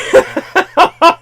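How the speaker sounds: big burst of laughter, high and loud. Real, not acted. Laughing hard at his own point about the film. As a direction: let a loud laugh break out.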